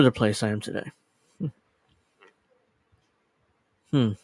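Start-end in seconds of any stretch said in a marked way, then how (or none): none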